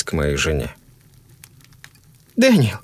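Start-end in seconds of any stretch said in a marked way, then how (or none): 2.4-2.8 high, wary cry of his name; more alarmed. A narrator's reading. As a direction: call out in growing alarm. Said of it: "ещё более настороженно произнесла Кэрол".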